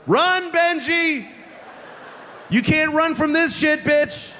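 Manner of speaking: high, drawn-out mock holler; gloating taunt. Teller to little Benji running into his house, gloating taunt. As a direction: call after someone fleeing, high-pitched and triumphant. Taunting after fleeing child.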